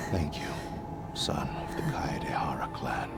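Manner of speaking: half-whispered last words, long pauses; fading spirit. Acted. Dying blade spirit thanks clan's son, fading whisper. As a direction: let the voice thin toward a whisper.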